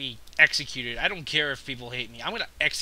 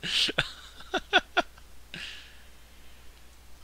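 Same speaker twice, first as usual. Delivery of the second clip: breathless laugh. Real, not acted. Laughing after defeat.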